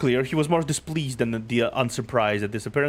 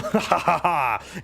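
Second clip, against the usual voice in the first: hearty laugh; 6 semitones higher than usual. Acted. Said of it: "warmly energetic laughter rang".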